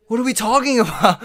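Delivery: indignant disbelief; incredulous outrage. Real, not acted. Ranting about police photo lineups, high and loud.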